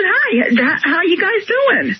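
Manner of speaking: slow, careful normal hello; drawn-out casual hello; demonstrating her greeting. Shown as a demonstration. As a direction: show she sounds fine. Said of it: "That's all I said".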